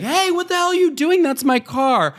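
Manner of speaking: high, indignant "what the hell", mock yell; indignant girl yelling. Girl catches stranger hitting her car.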